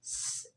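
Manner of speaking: a thin hissed s alone, no voice; whistled s. Shown as a demonstration. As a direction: let the s whistle out. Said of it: "ऐसे सीटी निकलेगी". (सीटी वाली स)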